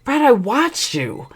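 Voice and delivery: high, drawn-out protest; indignant retort. Retold or imitated. Wife confronting husband over what she saw, indignant. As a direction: push back, high and insistent. Retold argument with cheating husband.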